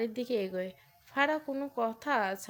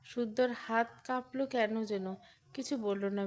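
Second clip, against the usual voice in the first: usual speaking voice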